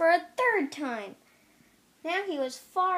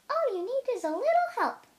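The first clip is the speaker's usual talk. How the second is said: cheering friends; 10 semitones higher, 3 dB louder, faster than usual. A narrator's reading. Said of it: "said Tortoise's friends".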